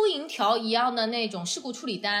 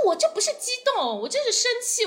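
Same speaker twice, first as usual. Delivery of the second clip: higher, indignant protest; a retold indignant retort; 12 semitones higher than usual. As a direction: insist you're angry, not excited. Retold argument with tow driver.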